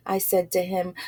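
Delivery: plain reading voice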